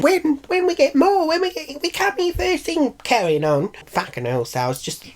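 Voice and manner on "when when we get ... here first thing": high-pitched squawking complaints; hammed-up high protest; mock angry crowd. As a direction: imitate an indignant crowd all carrying on. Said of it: "everyone there was getting angry".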